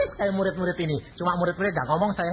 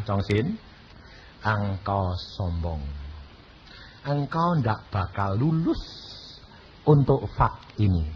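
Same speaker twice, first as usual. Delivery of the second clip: voice of God; 12 semitones lower, 4 dB quieter than usual. Retold or imitated. God rebukes him as proud.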